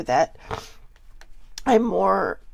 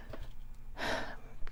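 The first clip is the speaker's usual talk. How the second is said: shaky unvoiced sigh; 13 dB quieter than usual. Real, not acted. Emotional pause while confiding.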